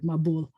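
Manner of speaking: "mabul" with final stress, ma-BUL; accent at end. Shown as a demonstration. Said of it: "have their accent at the end of the word".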